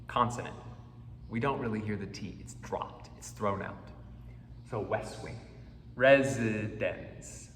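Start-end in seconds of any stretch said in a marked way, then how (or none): 6.0-7.5 slow demo word. Shown as a demonstration.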